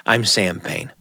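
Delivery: ordinary delivery, nothing marked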